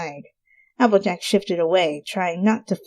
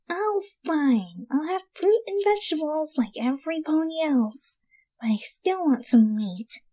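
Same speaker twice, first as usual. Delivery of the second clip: soft, sulky whisper; grudging whisper; 9 semitones higher, 5 dB quieter than usual. Acted. Rainbow grudgingly agrees to eat fruit with friends. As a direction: give in but keep the craving.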